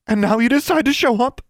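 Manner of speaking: loud, very high, incredulous; angry accusation. A narrator's reading. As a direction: throw it at her in outrage. Mother confronts Livia.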